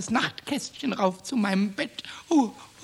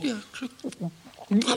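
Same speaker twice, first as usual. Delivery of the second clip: laughing swallow; much slower than usual. Acted. Swallowing the pill reluctantly.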